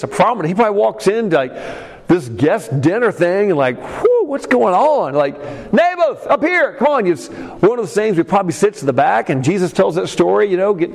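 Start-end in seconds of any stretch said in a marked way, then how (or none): 3.8-5.1 high and slow, wide-eyed confusion; bewildered guest. Pretend. Naboth walking into the banquet, high slow bewildered. Imagined Naboth arriving at dinner. 5.7-7.1 a high, bright call across the room. Pretend. Host calling Naboth to the head table, high eager call.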